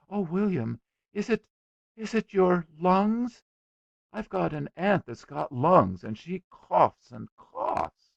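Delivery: put-on high-pitched, worried little girl; anxious little girl. A narrator's reading. Little girl fearing for friend's lungs, anxious.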